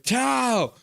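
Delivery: high mock shout of the name; hammed-up yell from chat; imitating a shout. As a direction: call out like a chatter yelling across. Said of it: "Calvin nine hundred was like".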